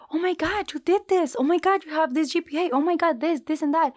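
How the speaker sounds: high, fast gushing recruiter; excited recruiter voice; impressed gushing. Retold or imitated. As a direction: rave about the resume.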